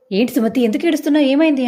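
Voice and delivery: worried alarm. Acted. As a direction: rush out the worry on the phone. Sister hears her crying on phone.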